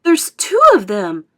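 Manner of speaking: loud astonished call; shouting. A narrator's reading. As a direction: shout the discovery out. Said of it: "everybody started shouting at once".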